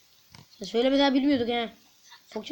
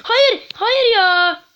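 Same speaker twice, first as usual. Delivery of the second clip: much higher and louder, anguished no; despairing scream; 10 semitones higher, 11 dB louder, much slower than usual. Real, not acted. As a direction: scream in disbelief at the fail. Fails the level.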